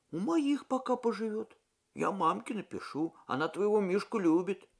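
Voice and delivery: lightly voiced young soldier, worried; young cadet voice.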